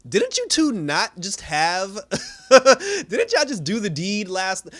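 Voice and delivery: high, loud, half-laughing disbelief; can't believe what she just watched; incredulous. Real, not acted. Host reacting to the TV couple, loud and incredulous.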